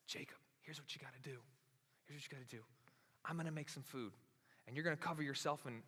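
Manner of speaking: hushed and quick, a mother plotting in secret; conspiratorial hush. Acted. Mother secretly coaching Jacob to steal the blessing, hushed.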